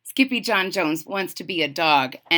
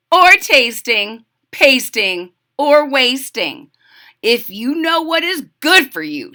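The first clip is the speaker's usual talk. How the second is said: warning mother; 4 semitones higher, 7 dB louder than usual. A narrator's reading. Mama warns Skippy about the red spice, firm warning. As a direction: warn word by word, louder and higher.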